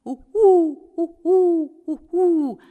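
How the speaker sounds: high hooting owl call. A narrator's reading. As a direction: hoot like an owl at night. Owl hoot sound imitated.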